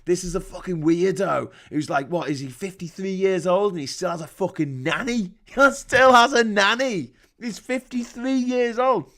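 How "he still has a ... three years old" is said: incredulous. Real, not acted. Incredulous about nanny.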